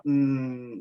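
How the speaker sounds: ordinary delivery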